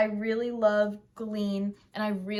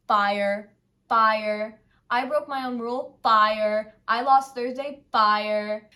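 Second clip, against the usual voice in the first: shouted fire; 8 dB louder, slower than usual. Real, not acted. Rating songs with loud fire.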